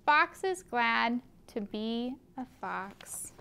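plain reading voice

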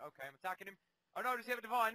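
normal talking voice

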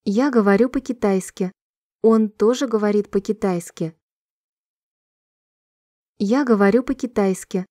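usual speaking voice

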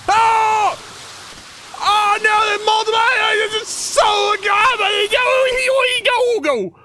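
very high, loud panicked shrieks; frantic screaming. Real, not acted. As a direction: scream to yourself to run. Chased by multiple goblins.

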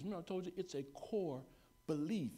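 ordinary talk

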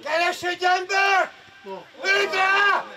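shouted coaching. Real, not acted. Coach urges a player to shoot, shouting. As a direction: yell it out to the pitch.

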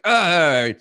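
high, loud exasperated ugh; frustrated groan. Retold or imitated. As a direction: let out a stressed groan. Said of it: "I'm like".

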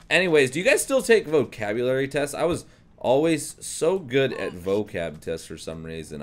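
ordinary talk

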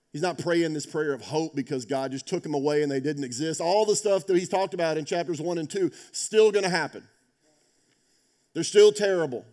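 preaching at full force. Acted. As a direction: drive it home, swelling with each phrase. Preaching climax on suffering.

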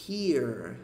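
"here" with pursed, rounded lips on the final r. Shown as a demonstration. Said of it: "Tá vendo que ela faz um biquinho?". ("here" com biquinho)

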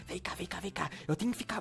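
soft, low call to come closer; quiet so the patient won't hear; hushed. Real, not acted. Calling Tezinho aside so the patient can't hear, hushed. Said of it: "vem falar baixo aqui, Tezinho, porque ele ainda escuta".

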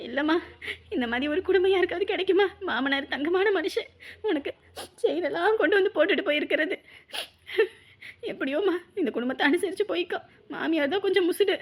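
soft, broken crying voice; halting, with small sobbing breaths; tearful. Acted. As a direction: advise your daughter through tears. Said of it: "நீ எதுக்கும்மா அழுதுட்டு இருக்க".

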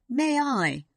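put-on eager child's request. A narrator's reading. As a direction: ask with keen hope, voice lifted. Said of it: "Sarah said eagerly".

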